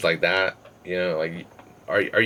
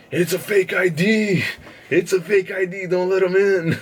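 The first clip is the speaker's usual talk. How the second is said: much higher, louder, frantic yelling; drunk friend shouting; 12 semitones higher, 4 dB louder than usual. Retold or imitated. Drunk friend yelling to the bouncer, high frantic shout. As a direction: yell it like a drunk snitch.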